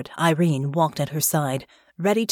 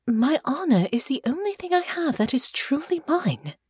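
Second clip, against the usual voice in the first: put-on proud young noblewoman; 9 semitones higher than usual. Honor voiced in the story.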